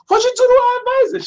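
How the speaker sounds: very high, loud imitated outcry; mock alarmed accusation. Pledges confront him over crying advisor, mock alarmed shriek.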